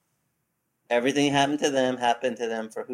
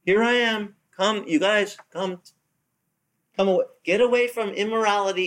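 pitched-up pleading call; the Lord calling; 7 semitones higher than usual. Retold or imitated. The Lord calling his people away from sin, pleading and higher. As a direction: call out like a worried parent. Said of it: "the Lord's saying".

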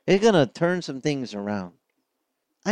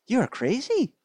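high, rising disbelief; incredulous; 10 semitones higher, slower than usual. Retold or imitated. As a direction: scoff high at a crazy idea. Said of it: "And I'd be like".

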